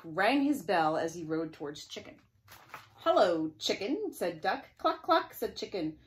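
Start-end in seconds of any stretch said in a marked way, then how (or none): 3.0-4.1 loud call rising high on Chicken; loud hello. Acted. Duck ringing his bell and calling the chicken, loud. 4.8-5.3 high, loud clucking; chicken cluck. Acted. Chicken answering the duck, high loud clucks.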